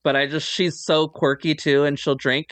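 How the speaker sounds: ordinary delivery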